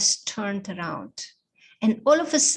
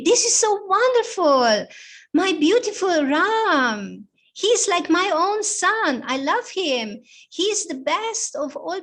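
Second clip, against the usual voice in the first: high, loud, gushing delight; the queen thrilled for her stepson; joyful queen crying out the news is wonderful; overjoyed queen; 7 semitones higher, 6 dB louder than usual. Acted. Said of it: "She was so happy".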